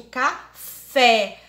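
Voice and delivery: "café" ending on a loud, open E; open vowel. Shown as a demonstration. Teacher explains the accented letter, open E in "café". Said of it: "fica com o som aberto".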